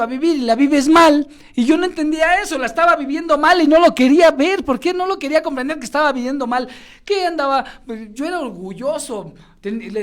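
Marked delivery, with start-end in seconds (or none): 0.0-6.1 higher and louder, rushing; impassioned outburst. Real, not acted. Speaker tells audience he lived badly, impassioned shout. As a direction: preach it hard and fast. Preaching climax about wasted life.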